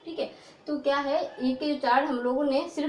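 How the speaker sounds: ordinary talk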